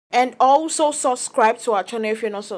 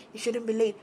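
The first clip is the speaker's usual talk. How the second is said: mimicked murmuring; 8 dB quieter than usual. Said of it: "were just there murmuring".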